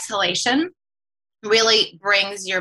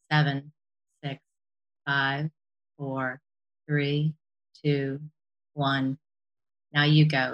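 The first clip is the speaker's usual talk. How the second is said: slow breath counting; 4 semitones lower, slower than usual. Acted. Leads a guided breathing exercise.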